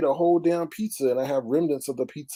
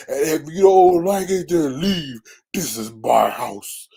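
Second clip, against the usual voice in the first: mock stern host; 7 dB louder, slower than usual.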